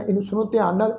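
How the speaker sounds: normal talking voice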